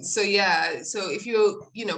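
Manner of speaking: ordinary delivery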